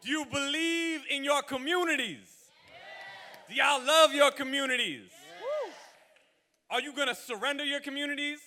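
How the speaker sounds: shouted rally call to the crowd; roaring rallying speech. Real, not acted. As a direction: rouse the crowd, full voice. Rally leader calling to crowd.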